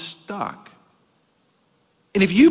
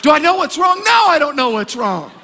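higher and louder, comic disbelief; incredulous outburst; 8 semitones higher, 4 dB louder than usual. Retold or imitated. Preacher mocking the operator's question, incredulous and loud. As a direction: throw the question back in mock outrage. Exasperated retelling of OnStar call.

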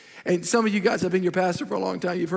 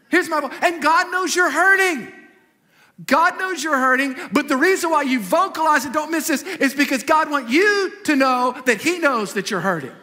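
loud, higher pitched climactic preaching; full-force preaching; 5 semitones higher, 4 dB louder than usual. Acted. Pastor drives his point home to the congregation, loud fervent preaching. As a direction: build the sermon to a fervent peak.

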